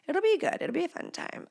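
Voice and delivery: tiny, high, soft voice; faint self-reassurance. Acted. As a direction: reassure yourself in a shrinking little voice. Squeaky self-reassurance after mock alarm.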